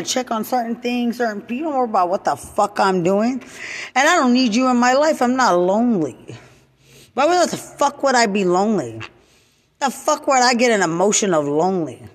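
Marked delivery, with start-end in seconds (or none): 3.9-8.1 fast, higher and louder furious declaration; fast, raised angry shout at the people gossiping; angry outburst. Real, not acted. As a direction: fire it at them fast. Angry rant at detractors.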